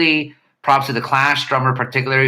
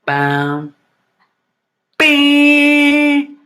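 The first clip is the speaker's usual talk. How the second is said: imitated instrument riff; 3 semitones higher, much slower than usual.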